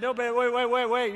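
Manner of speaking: mildly frantic dad talking her round; urgent pleading. Retold or imitated. Dad to daughter backing out of the line, urgent pleading. Father urging balking daughter to stay.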